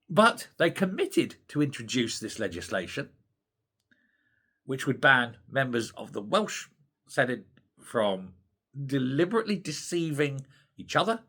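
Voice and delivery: ordinary delivery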